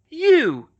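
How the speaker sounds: sudden, loud, high snarl. Acted. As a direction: hurl the word at the angel. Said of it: "she snarled".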